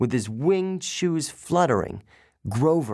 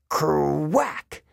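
ordinary delivery

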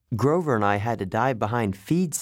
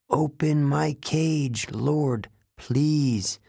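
higher, slow plea; pleading zebra; 5 semitones higher, much slower than usual. Acted. Zebra to its lord from the cage, pleading. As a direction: draw out each phrase, begging. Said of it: "The zebra said".

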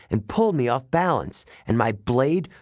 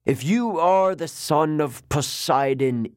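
higher, slow hissed taunt; hissing snake lady; 4 semitones higher, slower than usual. Acted. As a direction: hiss it out like a serpent mocking her prey. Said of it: "Echidna hissed".

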